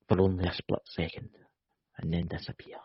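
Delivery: mock-dramatic hush, sinking away; hushed mystery. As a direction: drop low and hushed, fading to a whisper. Dramatic advert for Loch Ness creatures.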